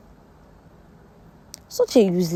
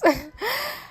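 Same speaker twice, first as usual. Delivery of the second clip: high laugh; 14 semitones higher than usual. Real, not acted. Vlogger laughing at Faith's line.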